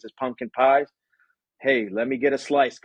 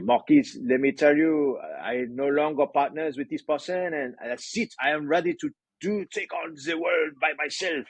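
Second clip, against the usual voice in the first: higher-pitched hammed-up French accent; horrible French accent; 4 semitones higher than usual. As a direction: put on a badly faked French accent. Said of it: "I'm gonna do a horrible French accent here".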